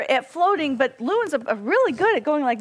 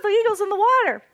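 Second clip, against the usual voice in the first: alarmed; 6 semitones higher, faster than usual. Retold or imitated. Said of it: "we were like".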